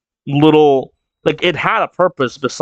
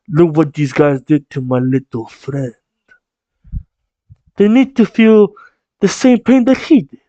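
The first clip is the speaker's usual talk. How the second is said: mafioso voice. Acted. Mob boss vowing revenge for his little friend, put-on mafioso voice. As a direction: play the mob boss demanding payback. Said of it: "one of those, uh, mafioso lines, uh, voices".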